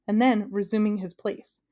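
usual speaking voice

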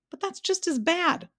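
high-pitched protest. A narrator's reading. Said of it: "protested Milo".

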